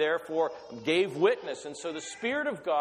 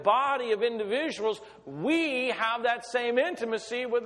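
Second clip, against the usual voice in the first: fervent preaching; 7 semitones higher than usual. Acted. Preacher stirring the congregation about intimacy with God, fervently. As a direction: lift the congregation with rising fervour.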